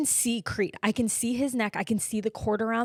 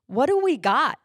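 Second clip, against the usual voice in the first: higher, louder eager question; imitated nurse asking; 5 semitones higher, 5 dB louder than usual. Nurse asking the room about the newborn, imitated raised call. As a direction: call it out brightly, pitch raised. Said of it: "one of the nurses go".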